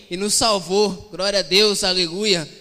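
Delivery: loud, emphatic church proclamation; real exultant praise. Real, not acted. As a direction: belt out praise to rouse the congregation. Preacher breaks into praise.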